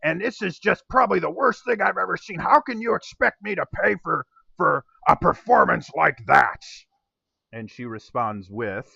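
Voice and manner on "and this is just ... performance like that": loud, raised angry yell at a singer; angry shout. Acted. Innkeeper yelling at the halfling singer, angry shout. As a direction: bellow your outrage at the performer. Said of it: "just basically screaming at the halfling".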